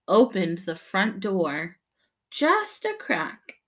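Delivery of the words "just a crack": much higher, slow suspense; suspenseful. A narrator's reading. Narrator building suspense as the door opens, high and slow. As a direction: draw it out, tiptoeing.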